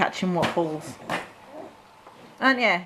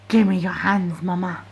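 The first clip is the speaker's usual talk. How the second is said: voicing the child; 3 semitones lower than usual. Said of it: "He's like".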